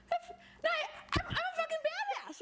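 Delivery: high, laughing cry of triumph; triumphant cry. Retold or imitated. Therapist acting out a client's triumph, high, laughing and tearful. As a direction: shout it like you just survived something huge. Triumph after emotional contraction.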